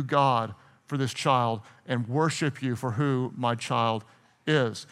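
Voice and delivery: soft low thankful prayer; whisper-close gratitude to God; tender prayer. Acted. Parent thanking God for their child, tender.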